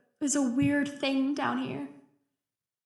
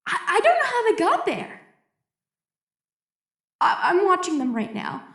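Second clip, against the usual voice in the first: higher and louder, words tumbling faster; rising panic; 5 semitones higher, 6 dB louder than usual. Acted. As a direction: fear building as she watches the eels. Alone in blackout, panic rising.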